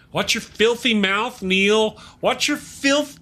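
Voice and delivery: high, slow, prissy telling-off; teasing a chat regular about his cussing; mock scolding. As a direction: play the shocked prude, tongue in cheek.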